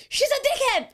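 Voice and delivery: high, loud, laughing blurt; laughing retort. Retold or imitated. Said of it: "And I was like".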